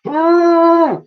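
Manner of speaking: very high trumpeting hoot; mammoth hoot. Acted. Woolley joining the crew's cheer, trumpeting hoot. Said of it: "hooted Woolley".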